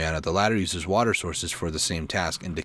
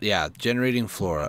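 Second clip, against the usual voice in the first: ordinary talk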